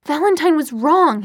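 heated insistence. A narrator's reading. Jace defending his cause to Clary, insistent. Jace argues back in quarrel.

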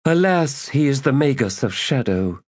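high, resigned sigh of a reply; weary priestess. Acted. Priestess explaining the magus to the lady, high and weary. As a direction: break the bad news wearily.

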